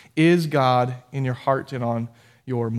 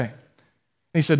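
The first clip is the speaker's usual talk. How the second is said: ordinary talk, nothing marked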